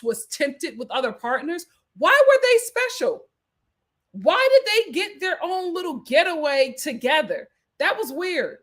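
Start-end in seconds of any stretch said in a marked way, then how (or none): 2.0-6.7 high, loud disbelieving question; incredulous. Real, not acted. Vlogger to viewers, high incredulous question. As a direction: pitch it up in disbelief.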